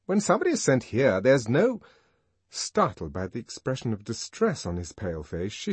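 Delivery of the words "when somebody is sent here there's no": high, quick nurse voice; a chatty hospital nurse; gentle calm nurse. Acted. Nurse answering the visitor.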